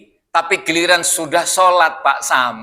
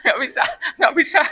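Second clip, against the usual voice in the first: laughing refusal; 9 semitones higher, faster than usual. Real, not acted. Laughs off Betawi prayer.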